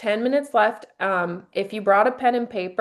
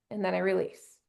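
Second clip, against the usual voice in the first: much softer, letting the breath go; soft release; 3 semitones lower, 8 dB quieter than usual. Shown as a demonstration. Showing the audience her exhale, much softer. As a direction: sink into the exhale, barely voiced. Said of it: "I fill my lungs even more".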